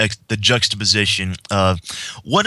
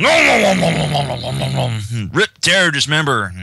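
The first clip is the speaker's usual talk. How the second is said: monster munching; 5 semitones higher than usual. Retold or imitated. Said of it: "Celestia is just going".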